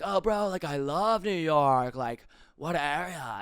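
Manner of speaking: slow, drawn-out chill bro; super high guy. Retold or imitated. Uber driver hearing about New York, chill bro drawl. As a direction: stretch it out like an enthusiastic stoner. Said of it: "guys who sounded super high".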